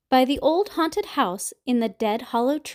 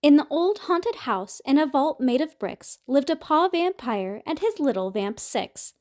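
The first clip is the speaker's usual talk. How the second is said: plain reading voice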